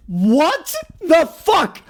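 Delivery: slow, high, drawn-out outburst; high-pitched, incredulous outrage; outraged disbelief. Real, not acted. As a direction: stretch out each word in exasperated disbelief. Outraged reaction to the rule.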